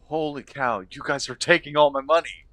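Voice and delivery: high, loud gamer complaint; exasperated outcry. Real, not acted. Player griping at companions over spent gold, loud exasperation. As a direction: burst out in loud, high-pitched disbelief.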